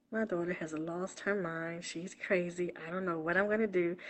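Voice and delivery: a hushed, mock-anxious aside; imitating worried mom. Voicing what her mom never said about her, softly imitating. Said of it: "She didn't sit like".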